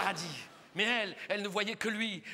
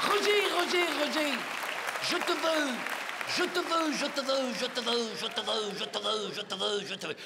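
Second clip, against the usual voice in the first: obsessed lover. Acted. Oula begs Roger to notice, obsessed and fading. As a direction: chant desire over and over, winding down. Lovestruck woman pleads with Roger.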